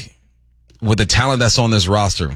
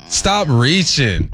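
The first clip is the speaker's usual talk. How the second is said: drawn-out retort; 4 semitones higher, much slower than usual. Pretend. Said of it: "repeats retort, drawn out".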